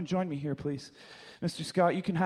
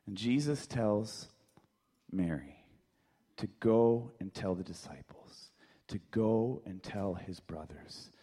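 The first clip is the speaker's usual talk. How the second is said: hushed storytelling; 8 semitones lower, 6 dB quieter than usual. Acted. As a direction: drop to a hush for the moment of recognition.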